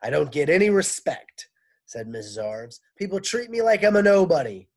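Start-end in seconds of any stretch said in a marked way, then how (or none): 0.0-1.5 raised, loud complaint; complaining teacher. Acted. Teacher griping to men, loud complaint. 3.0-4.7 raised, loud complaint; complaining teacher. Acted. Teacher griping to men, loud complaint.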